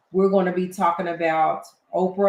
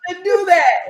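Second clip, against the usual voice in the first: high, loud words bursting through laughter; laughing admiration; 8 semitones higher, 7 dB louder, slower than usual. Real, not acted. Host gushing to Harlem about the accent, laughing. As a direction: laugh helplessly while gushing, pitch jumping up. Amused by co-host's New York accent.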